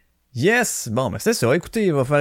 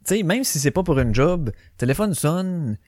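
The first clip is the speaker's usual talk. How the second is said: ordinary delivery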